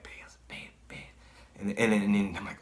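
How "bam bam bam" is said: very faint eerie whisper. Retold or imitated. Mass of bikers chanting the name, whispered. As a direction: whisper the name like a passing crowd. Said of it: "they're whispering the name".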